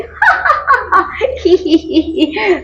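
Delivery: high put-on giggle; laughing parrot. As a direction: pretend ha-ha then squeaky hee-hee.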